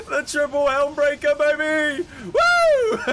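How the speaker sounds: loud, high celebratory yell through laughter; triumphant whoop. Real, not acted. Streamer landing a triple helm breaker, triumphant shout. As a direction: crow it loudly, ending in a squealed whoop.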